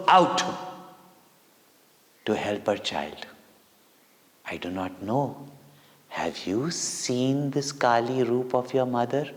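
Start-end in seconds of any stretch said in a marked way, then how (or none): none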